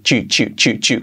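"chu" repeated, low, with a crisp ch; ch sound drill. Shown as a demonstration. Teacher drilling the merged sound, repeated "chu". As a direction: say "chu" for the joined d and y. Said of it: "como si fuera una H".

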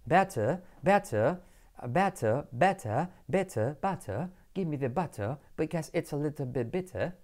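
soft British English with crisp t and no final r; British "better, butter", t clearly released; British accent. Shown as a demonstration. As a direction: put on a measured British accent. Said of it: "lo voy a hacer en inglés británico".

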